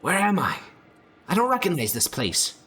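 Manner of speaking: high, strained lost-creature voice; a frightened monster asking where it is; high confused creature voice. Acted.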